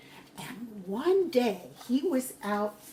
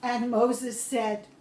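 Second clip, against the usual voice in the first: ordinary talk, nothing marked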